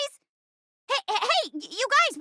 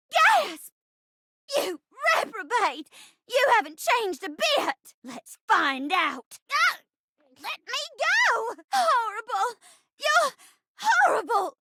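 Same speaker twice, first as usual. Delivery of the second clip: theatrical indignation at a villain; hammy, exclaimed outrage; melodramatic outraged heroine; slower than usual. Acted. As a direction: play it as a defiant stage bride. Acts heroine facing the villain.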